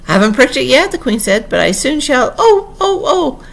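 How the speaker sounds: high, loud anxious wail. A narrator's reading. Queen dreads pricking her finger, wailing high. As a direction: build up into cries of "oh".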